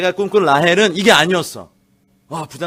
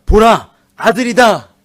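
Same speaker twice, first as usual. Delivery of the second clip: louder, higher and slow, like an announcement; proclaiming; 6 semitones higher, 10 dB louder, slower than usual. Acted. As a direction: declare it slowly and loudly. Proclaiming Leah's words. (레아의 감격을 크게 천천히 선포하듯)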